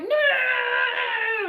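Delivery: high, loud hammed-up cry of no; mock anguished scream. Queen burning up in the flames, mock scream. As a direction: shriek it like a burning film queen. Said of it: "And she's like".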